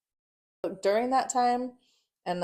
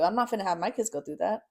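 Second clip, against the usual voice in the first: ordinary delivery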